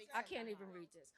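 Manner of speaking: giving up on the script, hurt; too upset to read, again. Real, not acted. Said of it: "I'm upset".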